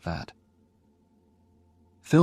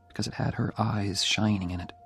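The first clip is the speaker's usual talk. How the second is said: ordinary delivery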